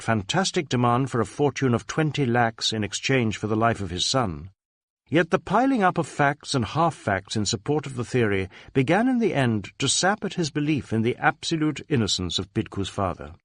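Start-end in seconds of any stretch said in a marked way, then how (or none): none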